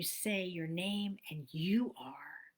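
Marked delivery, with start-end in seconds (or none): 1.5-2.5 whispered affirmation. Shown as a demonstration. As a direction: whisper it as if another voice tells you. Whispered second-person affirmation.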